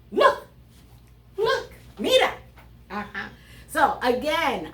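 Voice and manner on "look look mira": much higher and louder call; excited calling out. Shown as a demonstration. Teacher acting out the command sentence, calling out excitedly. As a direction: call out to get them to look. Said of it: "acting out a command".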